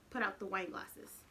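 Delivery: plain reading voice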